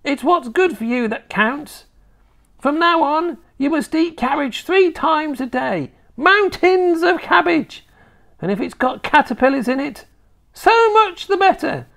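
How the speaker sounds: high, insistent grandma voice; bossy old grandma. Acted. Grandma ordering her grandson to eat cabbage, bossy. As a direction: lay down the law as a high-voiced old woman.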